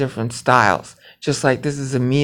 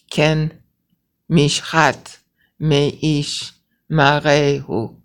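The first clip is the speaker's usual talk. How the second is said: slow demonstration reading; much slower than usual. Shown as a demonstration. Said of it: "See how I read it?".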